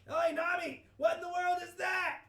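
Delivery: high, loud startled yell; alarmed shout. Acted. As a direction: shout a warning across deck. Acted alarm at strange sight.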